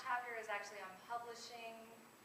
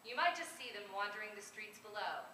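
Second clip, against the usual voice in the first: normal talking voice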